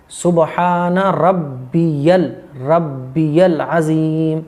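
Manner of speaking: very slow "रब्बियल अज़ीम", each syllable apart; careful correct recitation. Shown as a demonstration. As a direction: draw out "सुबहान" and "अज़ीम" at length. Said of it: "ठीक करके पढ़ना है". ("रब्बियल अज़ीम" साफ़, ठहर-ठहर कर)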